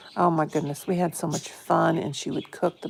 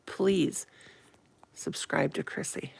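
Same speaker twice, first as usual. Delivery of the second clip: normal talking voice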